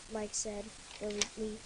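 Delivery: ordinary delivery, nothing marked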